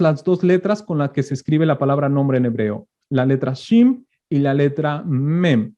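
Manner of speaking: ordinary delivery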